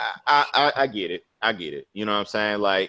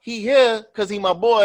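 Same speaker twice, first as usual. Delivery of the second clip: higher-pitched, indignant explaining; retold anger; 10 semitones higher, 4 dB louder than usual. Man arguing with his date about his friend, mad. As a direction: argue your case as in a retold spat. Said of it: "I was so mad after the movie".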